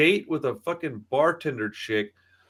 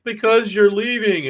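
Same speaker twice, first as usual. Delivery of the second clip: put-on high, slow little-boy voice; lightly mocked kid pleading; imitating a sad boy; 7 semitones higher, 5 dB louder, slower than usual. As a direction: play the heartbroken schoolboy. Little boy voiced in retold story.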